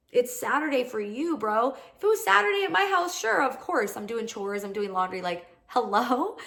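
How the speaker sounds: high-pitched, incredulous retort in a retold argument; exasperated comeback. Woman telling off her date about wasting Saturday, exasperated.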